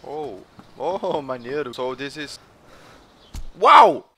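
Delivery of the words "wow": loud, high burst of wow; sudden amazed shout; surprised wow. Real, not acted. Sudden sight in game.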